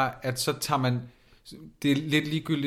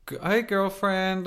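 high, slow sassy drawl; sing-song campy hello; 7 semitones higher, slower than usual. Retold or imitated. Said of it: "begyndte at bruge det der med".

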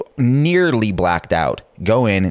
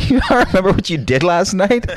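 incredulous manager; 13 semitones higher, 3 dB louder than usual. Retold or imitated. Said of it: "It's like".

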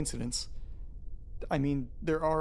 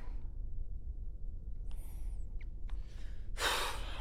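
slow, drawn-out breath out through the mouth; long shaky sigh; 7 dB quieter than usual. Acted. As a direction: let the tension drain out in one breath. Long sigh after calming himself.

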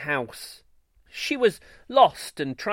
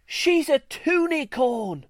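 high, slow awestruck exclaim; amazed; 10 semitones higher than usual. A narrator's reading. Crowd unicorn gaping at the baby, amazed. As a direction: stretch it out in wonder.

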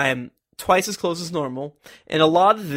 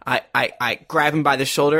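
normal talking voice

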